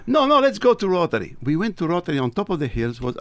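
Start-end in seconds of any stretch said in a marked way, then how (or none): 0.1-1.3 higher, louder insistent urging. Retold or imitated. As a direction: press him, no arguing. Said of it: "And he tells me".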